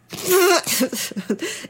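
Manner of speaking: high mocking cackle; high scornful bird laugh; raven's cackling laugh. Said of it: "krächzte ein rosagrün gestreifter".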